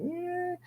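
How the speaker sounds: very high, soft mock wail; imitated crying. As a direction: imitate a sleeping child's sudden whimper. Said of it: "he'll just start crying".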